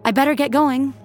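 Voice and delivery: forced cheeriness. A narrator's reading. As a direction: say it through a forced smile. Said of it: "She forced a smile".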